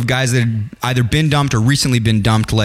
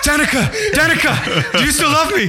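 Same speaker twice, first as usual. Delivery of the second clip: very high mock-desperate plea; hammed-up lovestruck caller; 14 semitones higher than usual. Husband parodying the phone scene to his wife, high mock-emotional plea. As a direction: plead it high, parodying a tearful reunion.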